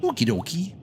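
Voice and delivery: "Okie dokie" sung out high and bright, rising on okie; high, sing-song "Okie dokie" with a lift on the end; chirpy okie dokie. Shown as a demonstration. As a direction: toss off "Okie dokie" like a cheerful vault dweller. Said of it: "Every time I say that, I think of the Fallout T V show".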